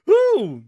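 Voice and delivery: high, loud real whoop of glee; woo whoop. Real, not acted. Streamer whooping with glee at his own joke. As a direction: let a whoop fly. Laughing outburst.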